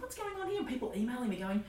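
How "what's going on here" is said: high, baffled question; bewildered. Retold or imitated. Woken by emails at night, high and baffled. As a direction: puzzle over the pinging inbox.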